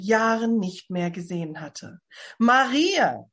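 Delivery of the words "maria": raised, louder glad cry of her name; surprised exclamation, higher and louder; calling out in surprise. A narrator's reading. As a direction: call out the name, startled and delighted. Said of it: "rief Elisabeth aus".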